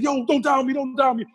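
high-pitched, panicked pleading in retelling; freaking out. Retold or imitated. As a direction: imitate a distraught friend begging. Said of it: "he started crying".

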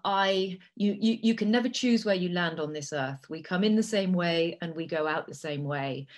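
ordinary talk, nothing marked

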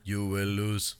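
Drago-style Russian boxer line; flat menacing Russian accent. Retold or imitated. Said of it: "the Russian from Rocky".

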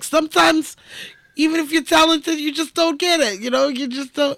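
high, loud mock lecture; condescending dad. Host mocking the dad's harsh advice, high and preachy. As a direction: talk down to her with fake wisdom. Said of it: "he does say some shitty shit".

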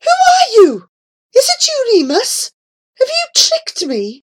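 high, loud, horrified questioning; voice of horror. A narrator's reading. Old man questioning the impostor, horrified high cries. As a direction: demand answers in shocked alarm. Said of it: "he cried in a voice of horror".

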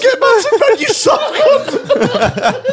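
high, laughing insult. Retold or imitated. Telling the brother to go back to bed, laughing hard. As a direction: wheeze it out through laughter.

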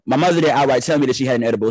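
normal talking voice

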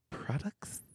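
products whispered with s; soft products; products plural. Shown as a demonstration. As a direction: end products on a hissed s. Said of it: "instead of".

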